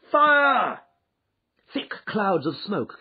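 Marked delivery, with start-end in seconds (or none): 0.1-0.8 very high, loud, sustained shriek. A narrator's reading. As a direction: shriek it like a whole panicked crowd. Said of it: "joined in a general shriek of".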